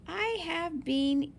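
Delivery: usual speaking voice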